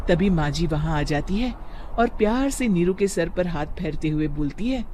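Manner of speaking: usual speaking voice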